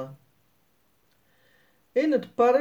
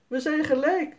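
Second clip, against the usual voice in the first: put-on higher child's voice, pleased; girl Sanne, pleased; 8 semitones higher than usual. A narrator's reading. Sanne tells Jasper the game is tied, higher and pleased. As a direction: say it like a girl glad it is a tie. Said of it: "zegt Sanne".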